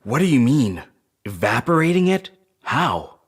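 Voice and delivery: can't believe what she just heard; incredulous surprise. Acted. Crew member reacts to the plan to evaporate the planet, incredulous.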